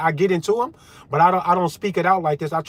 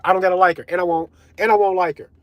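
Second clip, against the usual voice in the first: ordinary talk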